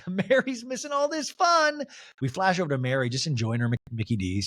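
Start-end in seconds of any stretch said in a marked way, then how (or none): 0.1-1.8 high, drawn-out mock lament; Heather impression. Castmate lamenting another missing the fight, high mock lament. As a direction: wail it lightly in a pitched-up voice. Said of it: "And Heather goes".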